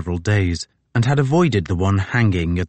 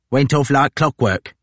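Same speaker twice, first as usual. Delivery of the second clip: higher, louder boasting soldier; brash boast; 6 semitones higher, 3 dB louder than usual. Acted. Tozer to Crozier, loud brash brag. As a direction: brag that it all went smoothly.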